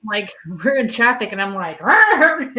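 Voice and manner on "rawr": mock roar. Growling at traffic.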